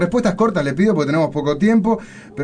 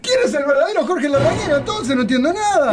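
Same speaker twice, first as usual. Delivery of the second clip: high-pitched, bewildered protest; baffled outcry; 11 semitones higher than usual. Pretend. Host faced with two rival Larrañagas, baffled and high-pitched. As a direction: throw up a high cry of total confusion.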